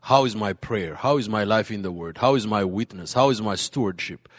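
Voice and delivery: ordinary delivery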